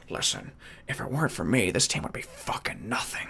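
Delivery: low, hushed cocky boast; self-styled varsity captain. Acted. Kid bragging about his football team, low cocky murmur. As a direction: drop it soft and low, full of himself. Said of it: "now he thinks he's the varsity captain".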